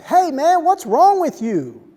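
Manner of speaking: loud, high, accusing yell; angry shout. Acted. Speaker confronting the man who dumped his dog, angry shout. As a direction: shout it as an outraged challenge, drawn out.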